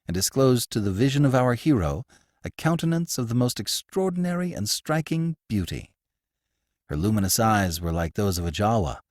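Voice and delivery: plain reading voice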